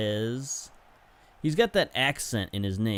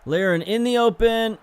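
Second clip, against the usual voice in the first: much higher, louder breakaway call; excited call; 8 semitones higher, 6 dB louder than usual. Real, not acted. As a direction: shout it as he breaks clear. Striker breaks through.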